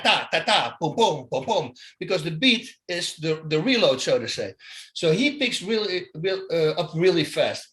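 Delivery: usual speaking voice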